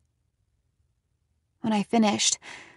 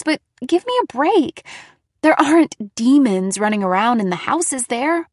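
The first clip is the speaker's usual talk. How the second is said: higher and louder, pleading; upset insisting; 4 semitones higher, 5 dB louder than usual. A narrator's reading. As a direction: argue the point, rattled. Daughter defends story, upset.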